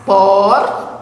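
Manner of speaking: "Porta" split as POR, long pause, TA; syllable by syllable. Shown as a demonstration. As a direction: leave a gap between the syllables of "Porta". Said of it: "Ela também tem duas sílabas". ("Porta" com pausa entre as sílabas: por... ta)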